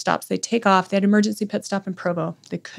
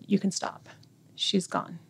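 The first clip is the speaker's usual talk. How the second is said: barely voiced, breaking bad news; soft whispered news; 8 dB quieter, slower than usual. Daughter telling the medic her mother is gone, whispered. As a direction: quietly whisper it, gentle and final.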